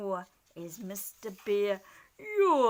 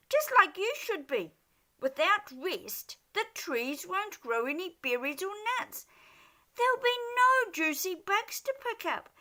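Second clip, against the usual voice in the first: high, loud scolding shout; 8 semitones higher, 5 dB louder than usual. Acted. Dormouse shouting at the hungry bear. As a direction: tell the bear off at full pitch. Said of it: "Dormouse shouted".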